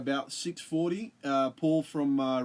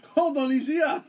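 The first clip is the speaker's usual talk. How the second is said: laughing; 12 semitones higher, 6 dB louder than usual. Real, not acted. Guest count joke, laughing.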